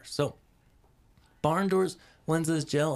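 normal talking voice

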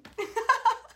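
squealing high laugh. Real, not acted. Picturing herself with a backpack, high laugh. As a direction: let a high laugh burst out.

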